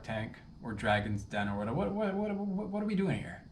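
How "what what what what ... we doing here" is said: baffled. Real, not acted. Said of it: "mock bewildered outburst".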